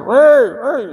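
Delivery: loud high shout of "woi"; calling out. Retold or imitated. Companions called from the market, loud high shout. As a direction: yell across a busy market. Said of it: "begini teriaknya gimana".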